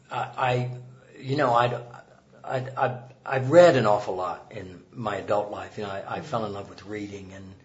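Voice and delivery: ordinary delivery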